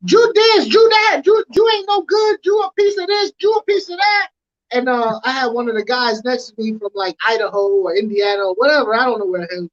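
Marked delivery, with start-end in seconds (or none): 0.1-4.3 imitating a yelling sergeant. Sergeant cussing out the formation, mock yell. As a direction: bark the insults like a sergeant chewing out formation. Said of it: "he'd be like".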